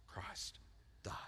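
Christ died whispered; hushed awe. Real, not acted. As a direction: drop to a breath on Christ died. Dramatic emphasis at verse end.

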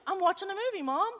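put-on high, childlike daughter voice; a light teen daughter voice; sleepy daughter. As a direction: play the half-asleep kid answering mom.

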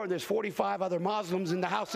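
ordinary talk, nothing marked

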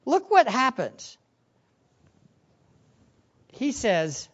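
ordinary delivery

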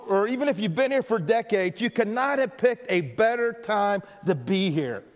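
usual speaking voice

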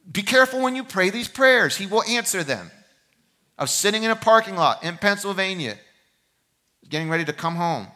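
loud, high, impassioned preacher's plea; preaching at full force. Acted. Pastor urging his congregation toward surrender, loud and high. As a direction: pour it out like a pulpit cry.

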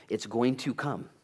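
ordinary talk

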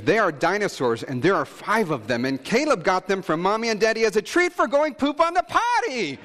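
put-on high child voice laughing. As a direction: pipe up like a proud little girl. Voicing daughter piping up.

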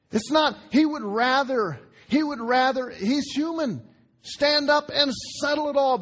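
high, forceful, urgent burst; worked-up protest. Acted. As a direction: push it up high as if ready to settle it all. Acts out Paul's urge to fight back.

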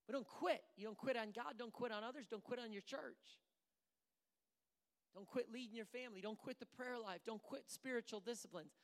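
high, loud, insistent exhortation; preacher urging the church never to quit; fervent preaching. Acted. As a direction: drive each "quit" home to the congregation. Preaching at full force.

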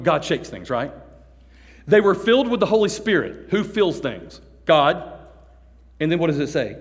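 ordinary delivery

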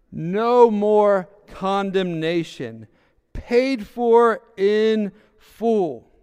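slow, loud preacher's declaration; emphatic proclamation. Real, not acted. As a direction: proclaim it slowly like a verdict.

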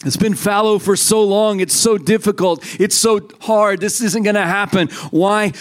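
high, fervent prophetic preaching; prophesying. Real, not acted. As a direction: declare it over the church with urgency. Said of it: "I'm gonna prophesy right now".

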